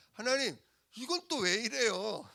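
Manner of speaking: high, whining question; complaining question. Acted. Preacher asking God when trouble hits, high whining. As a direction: whine it upward as a question to God. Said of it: "질문하는 거예요".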